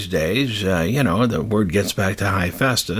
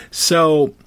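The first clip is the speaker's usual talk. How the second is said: usual speaking voice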